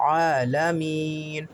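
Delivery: عالمین drawn out slowly; slow recitation. Shown as a demonstration. Teacher reciting عالمین slowly for students. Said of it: "یہ ملا کے پڑھا جائے گا".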